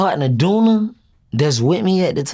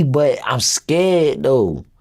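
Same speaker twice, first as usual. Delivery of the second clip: ordinary talk, nothing marked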